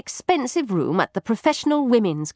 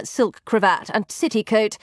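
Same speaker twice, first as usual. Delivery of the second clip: plain reading voice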